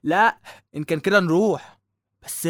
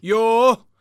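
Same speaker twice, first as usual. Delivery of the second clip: exasperated groan; 5 semitones higher, 7 dB louder, much slower than usual. Acted. Son fed up with mother's nagging.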